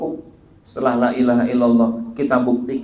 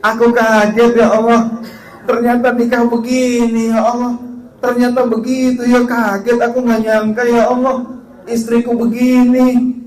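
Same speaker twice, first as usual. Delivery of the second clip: hammed-up lament; 9 semitones higher, 6 dB louder than usual. Husband lamenting to God, exaggerated.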